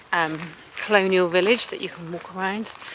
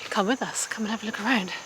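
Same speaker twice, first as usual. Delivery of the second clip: ordinary talk, nothing marked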